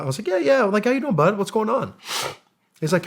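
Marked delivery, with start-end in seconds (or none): 0.3-0.6 high-pitched retold yes; pitched way up, keen to agree; eager recognition. Acts out own reply on call. 0.8-1.9 high, chummy retold hello; lifted, easygoing reply; friendly greeting. Acts out friendly greeting back.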